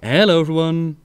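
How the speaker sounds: ordinary talk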